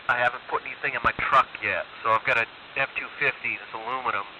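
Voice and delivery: ordinary delivery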